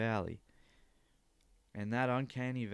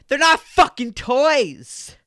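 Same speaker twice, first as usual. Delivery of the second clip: high, loud, slow mock rage scream; exploding mock instructor; mock furious scream; 19 dB louder, slower than usual. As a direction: blow up like the instructor. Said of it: "she's like".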